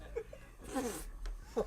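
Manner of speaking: breathless, silent wheeze of laughter; real helpless wheeze; wheezing laugh. Real, not acted. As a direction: laugh until no sound comes. Cracking up mid-story.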